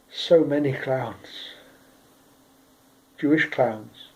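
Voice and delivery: slow, quiet listing with long pauses; sombre litany. A narrator's reading. Gravedigger recalling the limed bodies of victims, sombre. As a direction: let each phrase sink in, slow and subdued.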